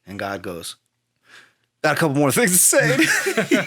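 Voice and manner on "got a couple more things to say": high voice with laughter through it; retelling how God keeps going after Job, laughing. Retold or imitated. God not done with Job, laughing.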